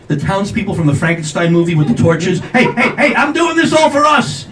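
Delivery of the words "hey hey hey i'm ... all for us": high-pitched plea to a crowd; calling out. Retold or imitated. Customer defending himself to the impatient line, calling out. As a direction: appeal urgently over an angry mob.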